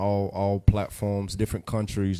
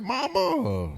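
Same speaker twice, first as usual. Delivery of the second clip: high-pitched mama greeting; 13 semitones higher than usual. Retold or imitated.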